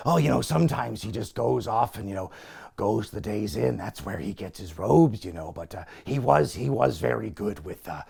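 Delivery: low, soft mumbling impression; imitating a low-key host making excuses; subdued Piper. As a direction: play down an outburst in a quiet, halting murmur. Piper excusing the Sheik's rant.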